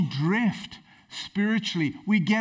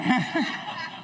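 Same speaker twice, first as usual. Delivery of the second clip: laughing; 6 semitones higher than usual. Real, not acted. Laughing at own joke.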